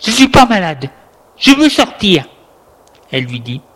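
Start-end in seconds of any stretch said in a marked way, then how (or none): 0.0-2.2 raised, higher-pitched protest; louder, insistent outburst; crying out. A narrator's reading. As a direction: cry out in defiance. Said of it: "Adrien lui crie".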